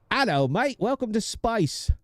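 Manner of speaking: high-pitched, hammed-up Aussie greeting; mock Aussie accent. Aussie computer voice welcoming the player, mock Australian accent. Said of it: "we need an Aussie be the new".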